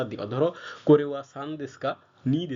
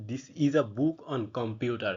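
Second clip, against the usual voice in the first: slow reading aloud; much slower than usual. Shown as a demonstration. Teacher reading the example sentence to students, very slow and deliberate. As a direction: read the English sentence out very slowly, word by word. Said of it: "ধরো এখানে কি লেখা আছে".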